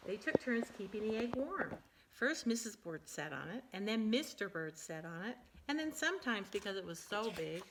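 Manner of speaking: lively, animated picture-book reading; reading to children. Acted. Parent reading a silly bird book to her child, animated. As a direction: read it big and bouncy for a small child.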